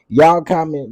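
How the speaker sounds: loud exclamation. Real, not acted. Realizes viewers are commenting.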